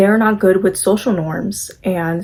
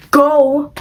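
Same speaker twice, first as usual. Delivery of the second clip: urgent order; 6 semitones higher than usual. Retold or imitated. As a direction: push her out the door with one word. Draculaura urging Frankie to stall.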